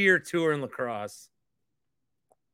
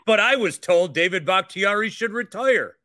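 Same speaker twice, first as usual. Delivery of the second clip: mocking fan; 5 semitones higher, 6 dB louder than usual. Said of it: "or like".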